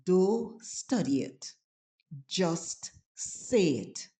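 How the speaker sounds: slow, drawn-out delivery; slow catchphrase. Shown as a demonstration. Signing off to tourists with the catchphrase, slow and drawn out. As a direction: lay it down slow and easy.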